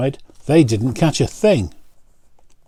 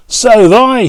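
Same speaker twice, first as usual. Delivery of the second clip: high, loud joyful shout; 11 semitones higher, 9 dB louder than usual. A narrator's reading. Charlie shouts his own catch to John, high and loud. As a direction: shout back the good news. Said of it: "shouted Charlie".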